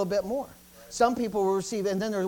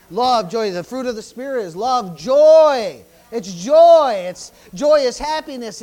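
much louder, ringing out the word joy; shouting joy; 2 semitones higher, 13 dB louder, slower than usual. Acted. Preacher proclaiming the fruit of the Spirit, shouted. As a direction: belt out joy to the church.

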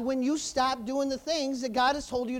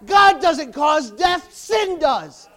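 fired-up preaching; 3 semitones higher, 8 dB louder than usual. Real, not acted. Preacher rousing the church against the devil and sin, fired up. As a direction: drive each point home with forceful, punched words. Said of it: "You got me fired up again".

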